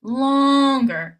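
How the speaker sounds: "longer" stretched out long. Shown as a demonstration. Teacher illustrating stress, drawn out longer. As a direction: hold the first vowel of "longer". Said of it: "hear our voice going".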